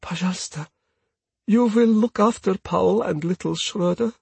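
high, lightly voiced Russian woman pleading; whispered plea. A narrator's reading. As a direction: plead in an accented voice, only mock-whispering. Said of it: "holds me back by the arm and whispers".

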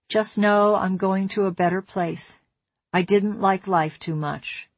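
usual speaking voice

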